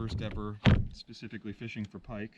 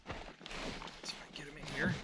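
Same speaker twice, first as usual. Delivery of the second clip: whisper; 8 dB quieter than usual. Real, not acted. Handling pike quietly.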